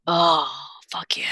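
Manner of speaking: slow, breathy whispered moan; pleasure moan. Shown as a demonstration. Showing how she moans over a bite of pizza, breathy whisper. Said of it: "if I take a bite of pizza".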